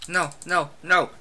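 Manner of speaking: normal talking voice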